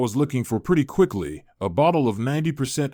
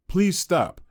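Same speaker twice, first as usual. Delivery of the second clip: yelled; 7 semitones higher than usual. A narrator's reading. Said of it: "Hannah yelled".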